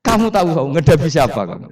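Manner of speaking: loud challenging yell; defiant shout. Acted. Umar shouting down the grave angels, loud defiant yell. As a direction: bark it back at the angels, fearless. Umar confronts Munkar Nakir.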